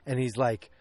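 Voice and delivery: ordinary talk, nothing marked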